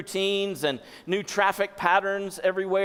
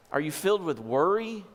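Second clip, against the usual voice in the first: ordinary talk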